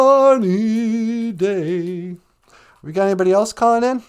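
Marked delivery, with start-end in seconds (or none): 0.0-2.2 drawn out, otherworldly; very slow odd voice. Acted. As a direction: stretch Sunny day very slowly.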